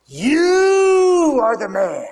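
slow, high, loud and grieving; solemn accusation. Acted. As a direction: drag out the words as a crying rebuke. Nathan confronts David.

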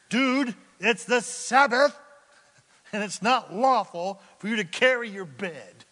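loud, indignant scolding voice; mock scolding. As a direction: scold him for breaking the rule.

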